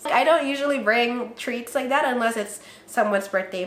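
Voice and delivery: ordinary talk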